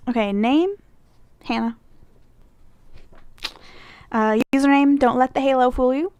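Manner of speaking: ordinary delivery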